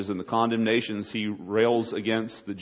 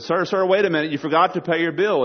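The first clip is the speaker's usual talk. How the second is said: higher-pitched waiter stopping a customer; urgent acted waiter call; 5 semitones higher, 4 dB louder than usual. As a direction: call after a diner who is leaving. Waiter calls after departing diner.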